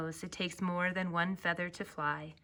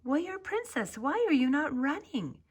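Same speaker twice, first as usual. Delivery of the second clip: higher, lightly voiced concerned ostrich; a friendly bird voice, pitched up and worried; a hint of a caring ostrich friend; ostrich Oscar asking; 9 semitones higher, 3 dB louder than usual. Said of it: "Oscar the ostrich stepped up next to her and asked".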